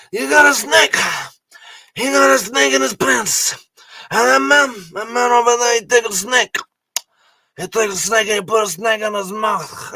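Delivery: high, shouted hammy Jon Voight snake-hunter voice; Jon Voight in Anaconda. Retold or imitated. Mocking a movie villain for the podcast audience, high hammy shouting. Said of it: "I'm doing Jon Voight in Anaconda right now".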